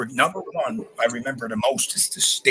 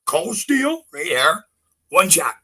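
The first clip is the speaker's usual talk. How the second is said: ordinary talk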